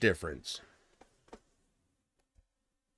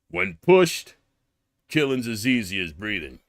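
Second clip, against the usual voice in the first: a hint of a grim movie tough guy; understated action hero line; lightly voiced action hero; 2 semitones lower than usual.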